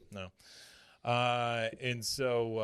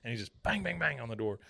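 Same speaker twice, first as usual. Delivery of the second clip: ordinary delivery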